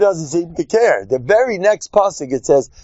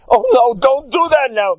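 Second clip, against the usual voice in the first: mock frantic outburst, raised and rushed; flaring temper; 10 semitones higher than usual. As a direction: snap in a higher, hurried pre-holiday panic. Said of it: "Now you see the tempers flaring".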